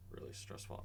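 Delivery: squeezed out on held breath; barely voiced, out of air; breathless whisper. Real, not acted. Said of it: "I'm holding my breath".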